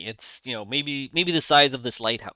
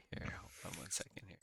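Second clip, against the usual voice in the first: near-whispered, very soft aside; hushed aside; 6 semitones lower, 21 dB quieter, faster than usual. Real, not acted. Game master stalling the table for a second, hushed aside. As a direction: say it under your breath to yourself.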